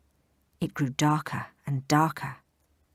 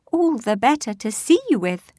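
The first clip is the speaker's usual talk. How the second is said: high, loud granny impression; wolf pretends to be grandma; fake granny voice; 7 semitones higher, 6 dB louder than usual.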